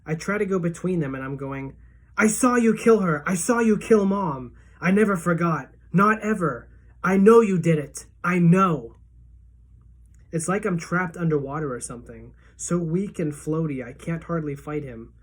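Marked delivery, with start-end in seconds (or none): 2.2-9.0 loud, high accusation; accusing shout. A narrator's reading. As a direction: hurl the truth at him. Boy confronts father during the attack.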